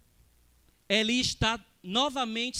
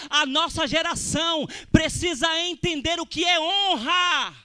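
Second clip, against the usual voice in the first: loud, impassioned pulpit delivery; full-force preaching; 4 semitones higher, 5 dB louder than usual. Real, not acted. Preacher tells the congregation what honour is, full-force preaching. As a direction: drive it home to the congregation, loud and high.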